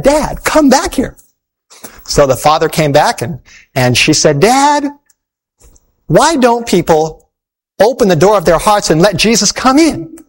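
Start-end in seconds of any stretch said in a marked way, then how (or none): 0.0-1.2 put-on high, slow child's call; little girl calling. Little girl calls her father back. 4.4-10.2 put-on high, slow earnest child's voice; little girl.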